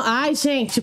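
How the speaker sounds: usual speaking voice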